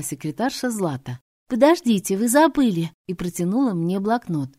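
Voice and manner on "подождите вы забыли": high, loud call after someone. Acted. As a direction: shout to stop them leaving. Secretary calls after them.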